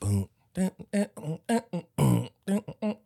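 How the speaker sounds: vocalized funk riff; sung bass riff. Retold or imitated. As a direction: mouth a funk bass line. Vocally imitating a funk bass line.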